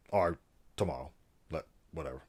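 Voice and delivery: loud, formal, announcer-style address voice; mock presidential address. Vlogger parodying a presidential speech to viewers, grand and formal. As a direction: play a head of state addressing the nation. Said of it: "I don't know why I keep doing this accent".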